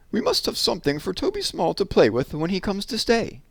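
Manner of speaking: a light touch of the mother figure; wife fondly making excuses for the clutter; lightly voiced wife. Missus Brown voiced.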